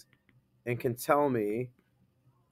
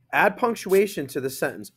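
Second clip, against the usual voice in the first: usual speaking voice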